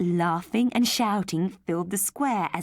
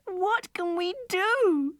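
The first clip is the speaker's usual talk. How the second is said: put-on high, slow, crying child's voice; a girl wailing for her teddy; despairing cry; 13 semitones higher, 4 dB louder, much slower than usual. Girl dismayed at the news.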